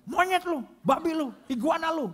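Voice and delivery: high-pitched angry driver hurling insults; mock-furious swearing at another driver; road-rage cursing. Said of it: "mobil marah-marah".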